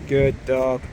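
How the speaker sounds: usual speaking voice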